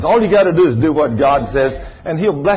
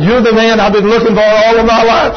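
raised, eager out-loud announcement; higher, louder retelling of her outburst; excited declaration; 8 semitones higher, 5 dB louder than usual. Retold or imitated. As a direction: proclaim it for the whole church to hear. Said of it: "she's not talking quiet either. She's talking out loud".

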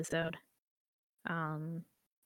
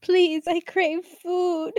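put-on high, slow pleading kid; begging child voice; 10 semitones higher, 10 dB louder, much slower than usual. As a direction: whine like a starving child. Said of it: "'Cause I am but a nine-year-old".

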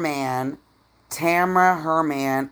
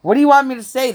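high and louder indignant; exasperated; 9 semitones higher, 7 dB louder, much faster than usual. Real, not acted. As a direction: throw up your hands, louder.

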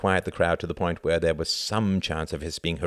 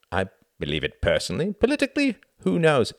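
light answer swooping high; glib evasion; 9 semitones higher, 3 dB louder, faster than usual. A narrator's reading. Reginald dodging Tom's question, glib. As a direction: toss it off, pitch jumping up.

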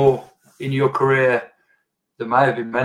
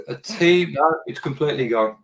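normal talking voice

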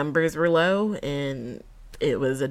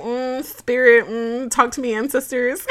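mock mystic invocation; 6 semitones higher, 4 dB louder than usual. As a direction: playfully summon the ancestors like a medium. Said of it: "And then I'm like".

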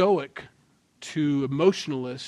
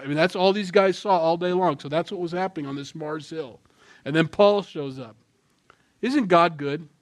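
ordinary talk, nothing marked

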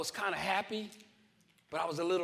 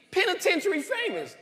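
much higher and louder, laughing at the irony of "Penitentiary famous"; amused repeat; 10 semitones higher, 4 dB louder than usual. Real, not acted. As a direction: toss back "Penitentiary famous" up high with a laugh. Repeats phrase, crowd laughing.

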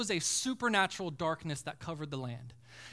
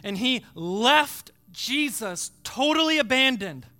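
impassioned preaching; 4 semitones higher, 7 dB louder than usual. Real, not acted. Preacher climaxes on total abandonment.

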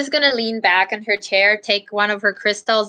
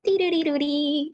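higher, sing-song dee-doo-dee-doo-dee; tiny twirling jingle; 5 semitones higher, 6 dB quieter than usual. Retold or imitated. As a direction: imitate a little crystal spinning. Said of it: "the crystal kinda, like, does a tiny".

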